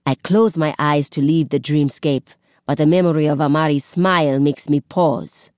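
ordinary delivery

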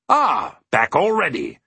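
exclaimed greeting from a character; hearty cry of greeting. A narrator's reading. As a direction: cry out a delighted hello. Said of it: "he cried, greeting him".

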